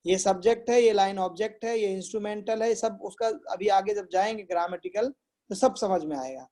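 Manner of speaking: ordinary talk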